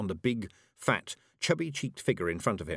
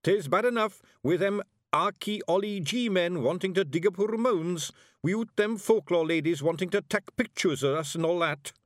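high, grumbling Scottish pixie; a wee blue man complaining; Scots Feegle; 10 semitones higher, 4 dB louder than usual. A narrator's reading. Rob Anybody grumbles to Miss Level in a high Scots voice.

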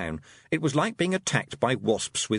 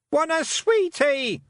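put-on much higher, slow toddler shout; little boy shouting; 4 dB louder, slower than usual. A narrator's reading. Wentworth demanding sweets, high toddler shout. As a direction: blurt like a greedy toddler. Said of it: "shouted Wentworth".

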